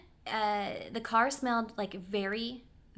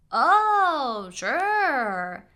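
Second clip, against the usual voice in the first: loud, high, drawn-out mock agreement; sarcastic; 5 semitones higher, 10 dB louder than usual. Driver grudgingly answering a passenger's window request, sarcastic. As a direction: imitate a huge sarcastic consent. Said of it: "traditionally think sounds like sarcasm".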